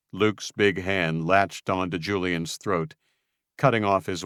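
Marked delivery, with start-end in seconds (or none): none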